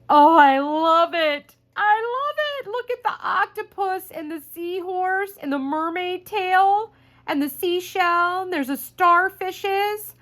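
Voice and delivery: very high, excited squeal of delight; real delighted gushing. Real, not acted. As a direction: gush over it, stretching words in delight. Thrilled reaction to opening the gift.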